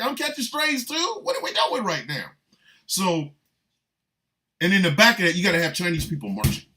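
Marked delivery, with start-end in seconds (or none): 0.0-2.3 high-pitched incredulous question; mock offended disbelief. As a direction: play the innocent bystander dragged into it, pitched up. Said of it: "Rosie's like".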